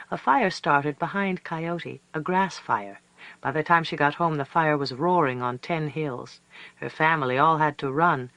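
usual speaking voice

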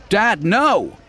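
high, loud protest; desperate cry. Acted. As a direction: cry out in alarm. Daughter protests father's order.

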